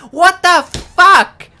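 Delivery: high, loud, slow disbelieving yell; shocked angry shout; exasperated outburst. Real, not acted. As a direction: blow up in disbelief.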